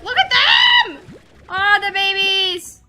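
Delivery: very high, loud, slow squeals breaking into cries; real overwhelmed delight, laughing and shrieking; ecstatic squealing. Real, not acted. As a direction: lose it over the babies. Squealing at cute slimes.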